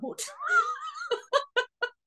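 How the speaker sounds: high-pitched burst of real laughter; real high giggling fit; laughing at oneself. Real, not acted. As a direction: laugh helplessly at your own confusion. Laughs at own confusion.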